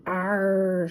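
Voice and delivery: a dog's playful pirate arr; pirate growl. A narrator's reading. Scooby acting out the pirate for the gang, growling. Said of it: "Scooby growled".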